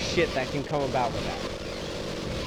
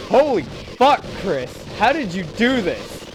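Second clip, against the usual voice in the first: amazed shout; 5 semitones higher, 8 dB louder than usual. Retold or imitated. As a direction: shout it in disbelief. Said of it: "then you'll say".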